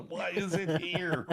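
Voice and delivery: high, loud, slow mock wail; laughing, incredulous protest; hammed-up plea, drawn out; exasperated disbelief. Retold confrontation with roommate.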